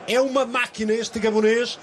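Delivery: normal talking voice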